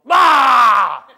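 a sudden blasting shouted "bah"; shouted sheep bleat; loud lamb bleat. Retold or imitated. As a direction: bleat right in their face like a lamb. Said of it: "a lamb walk up to you and go".

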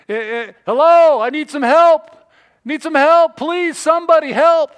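loud, high desperate call; crying out for help. Acted. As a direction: shout up a cliff for rescue. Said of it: "begins to cry out and call out".

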